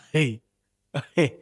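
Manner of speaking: ordinary delivery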